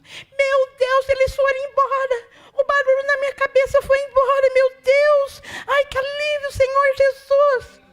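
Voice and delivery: loud, high cry of relief; high joyful wail of release; relieved outcry. Retold or imitated. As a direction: cry out freed and overjoyed. Relieved woman freed from voices.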